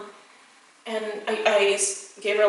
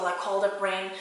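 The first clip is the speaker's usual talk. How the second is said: ordinary talk, nothing marked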